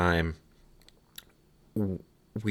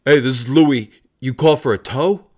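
tow guy voice; 5 semitones higher, 10 dB louder than usual. Retold or imitated. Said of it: "the guy calls".

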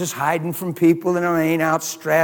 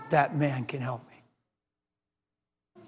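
quiet thought; 5 semitones lower, 12 dB quieter, much slower than usual. Retold or imitated. Preacher quoting his own thought.

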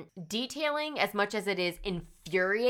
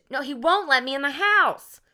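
distraught social worker; 7 semitones higher, 7 dB louder than usual. Retold or imitated. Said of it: "she's very, like, distraught".

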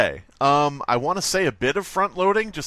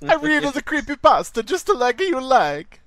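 high, cartoonish Italian accent; badly faked Italian sing-song; 10 semitones higher, 3 dB louder than usual. Playing along as another Tony, hammed-up Italian accent. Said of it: "half-assed Italian accent".

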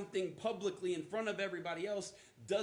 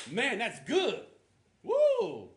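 "Man, that's good" called out loud and high, then a big "Woo!"; hyped-up church shout; 7 semitones higher, 11 dB louder, much slower than usual. Retold or imitated. Congregant carried away by a preacher, loud excited whoop. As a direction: holler like a fired-up congregant. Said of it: "can make me go".

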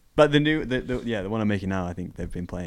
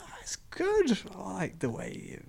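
much higher, soft mock mum voice; imitating mum; 11 semitones higher, 11 dB quieter, slower than usual. Son imitating his mum praising his game, high and soft. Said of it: "She was like".

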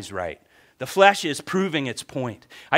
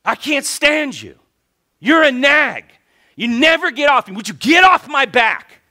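high, loud angry shout; acted furious outburst, rising in volume; escalated resentment; 9 semitones higher, 10 dB louder than usual. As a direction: blow up at your wife. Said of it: "And then it goes next level".

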